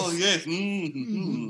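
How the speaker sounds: high creaky Igor voice; Igor hunchback voice. Acted. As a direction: play the hunchback assistant. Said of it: "Igor style hunchback voice".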